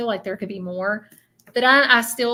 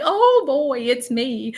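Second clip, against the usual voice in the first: excited; 3 semitones higher, 4 dB louder, slower than usual. Retold or imitated. Medium relaying the spirit's words to guest, mildly excited. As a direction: relay a spirit's eager oh boy. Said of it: "I was, like, so excited".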